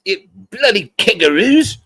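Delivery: high, slow hammed-up English accent; bad English accent. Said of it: "a really bad English accent".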